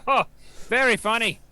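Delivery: sarcastic. Acted. Sarcastic reply to teasing.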